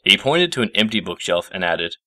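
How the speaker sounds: ordinary delivery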